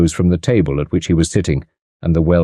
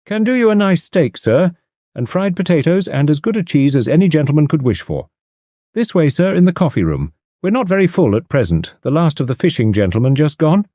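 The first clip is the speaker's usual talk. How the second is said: higher, beaming innkeeper; fawning landlord showing a guest in, higher; ingratiating landlord; 8 semitones higher than usual. Acted. Landlord figure held.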